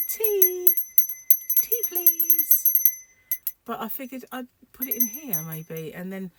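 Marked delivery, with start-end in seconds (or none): 0.1-2.7 very high, drawn-out held notes; tiny plea. Acted. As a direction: pipe it up high, asking for tea. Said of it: "Oh, I could just go".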